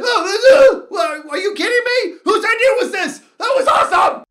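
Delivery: high, loud yelling, thrilled and appalled; re-enacted high-pitched excited rant; incredulous outburst. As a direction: shout it in delighted disbelief. Acts out own thrilled, upset response.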